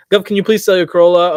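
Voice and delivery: reads the viewer's question out in a loud, booming call. Acted. Reading a viewer's plea to the host, loud call. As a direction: belt it out like a chat shout-out.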